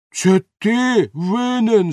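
high, loud, drawn-out retort; angry shouting. A narrator's reading. Baker turns the blame on his helper, angry shout. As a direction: throw the blame back, stretching each word. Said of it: "закричав Бомштекс".

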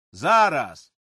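loud short shout. A narrator's reading. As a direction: snap back loudly. Father shouts to overseer. (Григор роздратовано гукає десятникові)

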